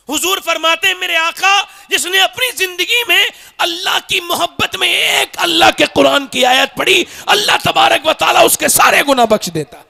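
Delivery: impassioned preaching. Real, not acted. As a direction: proclaim it fervently to the congregation.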